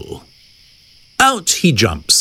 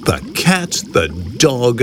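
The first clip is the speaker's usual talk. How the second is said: ordinary delivery, nothing marked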